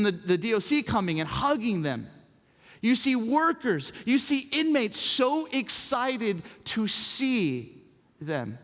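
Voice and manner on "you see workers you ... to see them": ordinary talk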